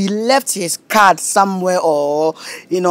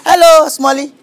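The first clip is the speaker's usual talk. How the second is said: mocking shout; 7 semitones higher, 5 dB louder than usual. Manager mocking the customer loudly.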